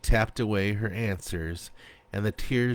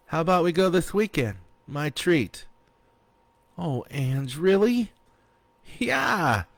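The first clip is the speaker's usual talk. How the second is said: excited offer; 8 semitones higher than usual. A narrator's reading. Friends excited about plan.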